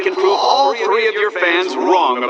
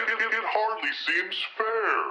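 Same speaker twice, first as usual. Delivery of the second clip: softer, distant echo of the line; soft echoing repeat; 6 semitones higher, 10 dB quieter than usual. Acted. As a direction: let it fade like an echo.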